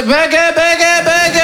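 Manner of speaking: high, repeated vulture-like jeer; mocking taunt. Family pouncing on a stutter, high mocking taunt. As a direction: jeer it over and over.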